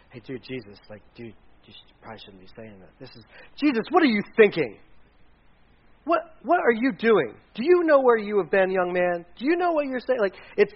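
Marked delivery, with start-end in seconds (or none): 0.1-2.9 quiet, low, casual aside; mild reproach. Shown as a demonstration. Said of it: "reprimand doesn't, isn't like". 3.6-10.2 high-pitched, loud, indignant scolding; harsh reprimand. Shown as a demonstration. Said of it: "it's a very harsh".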